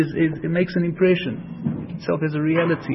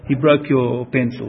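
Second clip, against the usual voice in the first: ordinary talk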